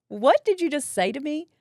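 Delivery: high, loud affronted comeback. Retold or imitated. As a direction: throw the question back, loud and offended. Retold affronted comeback to the lab girl.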